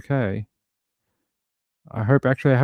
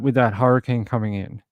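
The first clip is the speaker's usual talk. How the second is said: ordinary delivery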